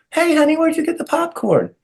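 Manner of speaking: high, sugary put-on voice; imitating Louise. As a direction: sweet-talk him like a diva. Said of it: "Louise is like".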